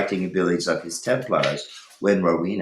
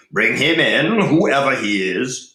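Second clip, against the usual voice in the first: commanding Cedric; 5 semitones higher, 7 dB louder than usual. A narrator's reading. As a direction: call the stranger in with authority. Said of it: "ordered Cedric".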